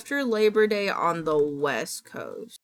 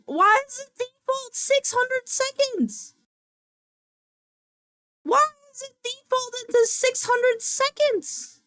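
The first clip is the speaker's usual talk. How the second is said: distressed wail. Real, not acted. Streamer protesting the default timeout, wailing. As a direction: wail the complaint in a high, held pitch.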